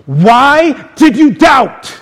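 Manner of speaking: high, forceful scolding, each word drawn out; harsh rebuke. Shown as a demonstration. Preacher showing how Jesus doesn't speak to Peter, harsh rebuke. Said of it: "Jesus doesn't speak like that".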